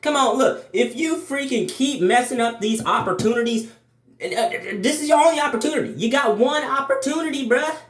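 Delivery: a hyped-up little devil, mock scolding; devil on the shoulder. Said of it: "the bad side ... he was talking about".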